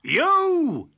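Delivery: loud high accusing shout; angry cry. Acted. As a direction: bark it at the wolf. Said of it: "he cried".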